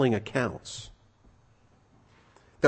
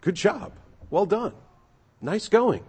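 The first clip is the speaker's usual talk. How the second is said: lightly voiced, higher praise; praising master; 6 semitones higher, slower than usual. Master praising servant for his work, higher and pleased. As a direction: cheer the servant on. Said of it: "It's about what he's produced".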